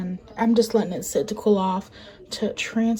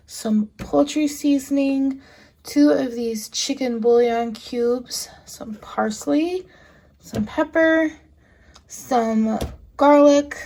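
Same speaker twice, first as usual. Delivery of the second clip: ordinary talk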